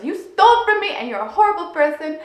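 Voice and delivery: loud, hurt accusing shout; angry child's outburst. Retold or imitated. Six-year-old accusing mom of stealing, angry yell. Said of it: "In my brain, it was".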